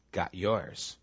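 secretly pleased they got theirs; smug inner thought. Retold or imitated. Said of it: "part of me thinks".

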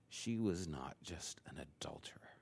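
soft, breathy hushed close to the sermon; hushed whisper. Real, not acted. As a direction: drop to a near whisper to land the point. Solemn closing point of sermon.

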